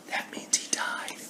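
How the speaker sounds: hushed, unvoiced aside; dark whispered punchline. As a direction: breathe it out like a secret. Deadpan aside after "Good.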